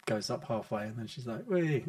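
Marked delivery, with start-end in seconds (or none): 1.5-1.8 bright playful squeal with a laugh; gleeful whee. Retold or imitated. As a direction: imitate a kid's delighted whee. Quoting daughter on climbing wall.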